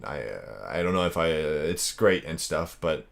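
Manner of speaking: ordinary talk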